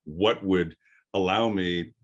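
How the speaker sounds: ordinary delivery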